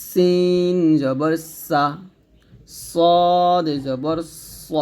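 সা and স stretched slowly with a whistling hiss; safir whistle. Shown as a demonstration. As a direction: let the air hiss out on সা and স. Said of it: "শীষ দিয়ে".

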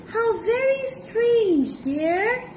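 high, slow, drawn-out wonder; mock-puzzled wife voice; feigned innocence. A narrator's reading. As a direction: play innocent in a high sweet coo. Wife feigns innocence after secret feast.

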